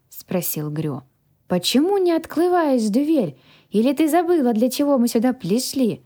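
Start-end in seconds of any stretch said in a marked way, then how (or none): 1.5-6.0 put-on young dragon, high and lisping; childlike dragon guardian, indignant. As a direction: pester her like a puzzled little creature.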